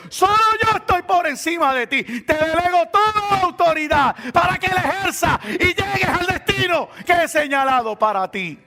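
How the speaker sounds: high, loud preacher's shout as God. Acted. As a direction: thunder God's promise from the pulpit. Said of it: "Dios me está diciendo".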